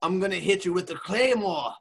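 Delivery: wrestler promo in a mock Scottish accent; Drew McIntyre. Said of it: "Irish, and Scottish".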